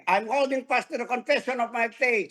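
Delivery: call-and-response affirmation; leading a declaration. Shown as a demonstration. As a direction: declare it boldly with the congregation. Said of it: "Say it with me". (malakas na pagpapahayag kasama ang kongregasyon)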